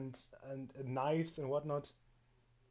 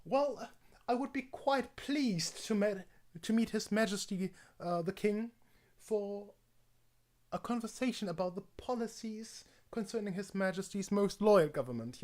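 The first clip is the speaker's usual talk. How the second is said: higher, measured posh English voice; posh English accent; 5 semitones higher than usual. Shown as a demonstration. Pleased to meet the King, posh English accent. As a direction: put on a formal upper-class English accent. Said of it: "either very posh or very unposh".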